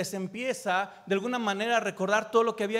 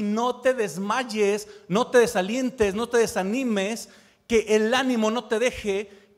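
louder, urgent call not to lose heart; fervent preacher, louder and pressing; forceful preaching; 2 semitones higher, 8 dB louder than usual. Acted. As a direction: drive each "no te" home like a rallying cry. Preacher exhorts at full force.

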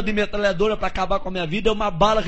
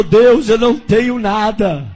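fervent prayer; 5 dB louder than usual. Retold or imitated. Man praying aloud.

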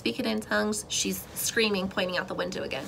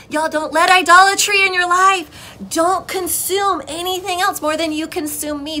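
loud, high-pitched acted plea; urgent warning; 8 semitones higher, 9 dB louder than usual. Voicing God warning his people, urgent warning. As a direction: cry out a warning to loved ones.

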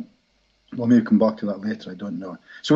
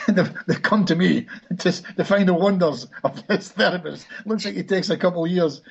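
higher-pitched, amused self-mockery; chuckling thought; 7 semitones higher than usual. Therapist mocking his own plight to himself, chuckling. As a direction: say it through suppressed laughter.

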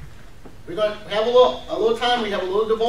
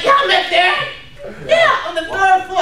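higher, animated acted boast; excited reminiscing; 4 semitones higher than usual. Member recalling where the church used to meet, excitedly. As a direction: play an old member eagerly recalling the spot. Future old-timer reminiscing proudly.

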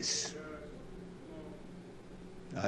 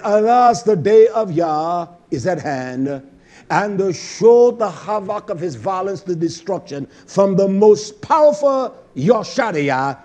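forceful preacher's quotation; declaimed scripture; 5 semitones higher, 6 dB louder than usual. Real, not acted. Preacher proclaims the day of Yah, declaimed scripture. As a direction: proclaim it loudly, like a dire warning.

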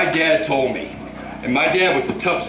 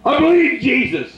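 high, shouted renunciation, much higher; shouted prayer; 11 semitones higher than usual. Real, not acted. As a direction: cast it out at the top of the voice.

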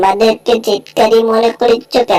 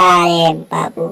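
ordinary delivery

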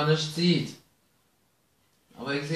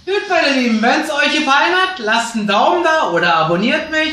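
bright, louder sign-off to viewers; vlog outro; 6 semitones higher, 6 dB louder than usual. Acted. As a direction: address your YouTube audience. Video-Abschied an Zuschauer. (fröhliche Verabschiedung ans Publikum)

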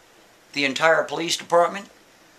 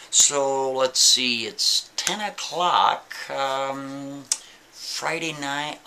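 drawn out slowly and teasingly; a hint of a gloating captain, very slow; slow taunt; much slower than usual. Captain gloating to the prisoner about the long weekend, slow taunt.

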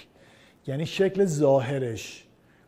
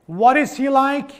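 raised model question; 6 semitones higher, 5 dB louder, slower than usual. Shown as a demonstration. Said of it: "teacher models the question".

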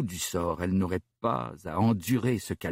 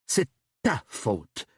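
slow, spat-out blame; cursing; 3 semitones higher, much slower than usual. Acted. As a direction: curse him, very slowly. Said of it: "pesta-t-elle". (reproche craché et lent)

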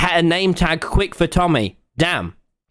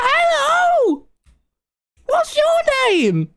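delighted hello; slower than usual. Real, not acted. Greeting his new spider pet with a delighted squealing hello.

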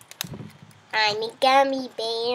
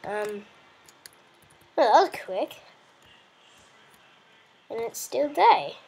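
ordinary talk, nothing marked

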